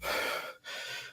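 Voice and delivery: soft, voiceless exhale; mimed weary sigh; acted negative sigh. Shown as a demonstration. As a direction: let out a quiet fed-up breath. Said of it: "they have this look like".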